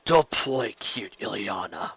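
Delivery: slow, low-key contemptuous sneer. Acted. Dragon confronting the queen, sneering. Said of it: "Rosa sneered".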